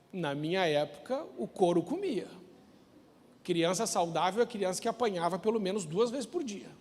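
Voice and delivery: ordinary talk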